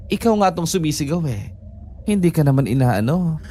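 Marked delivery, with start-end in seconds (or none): none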